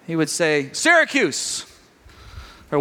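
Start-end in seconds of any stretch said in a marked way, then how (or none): 0.7-1.6 player calling out. Retold or imitated. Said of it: "they would shout it out".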